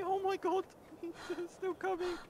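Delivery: rising into a high, loud panic; high-pitched alarmed cry; panicked squeal. Real, not acted. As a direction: squeal as the thing chases you. Chased by a creature in game.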